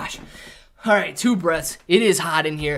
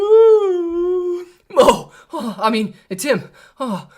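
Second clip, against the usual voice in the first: loud high startled yelp; startled scream; 10 semitones higher, 7 dB louder, slower than usual. Real, not acted. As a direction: jump at a sudden fright. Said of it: "You scared me a little bit".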